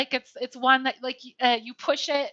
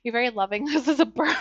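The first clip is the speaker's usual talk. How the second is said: usual speaking voice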